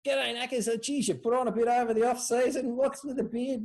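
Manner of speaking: a lightly imitated high, ribbing bloke voice; blokey banter. Narrator imitating blokes ribbing each other at training, high and teasing. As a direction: tease a teammate about his beard, mildly mocking. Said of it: "all these sorts of comments are going".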